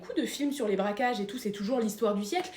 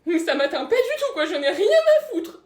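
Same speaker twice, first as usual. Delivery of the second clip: forced laughing denial; 6 semitones higher, 8 dB louder than usual. Acted.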